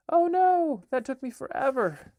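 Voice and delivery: high, loud oh no; child upset over a ruined page; dismayed cry. Acted. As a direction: squeal it in dismay. Child sees page splashed.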